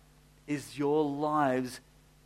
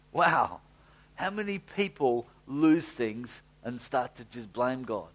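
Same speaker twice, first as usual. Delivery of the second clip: usual speaking voice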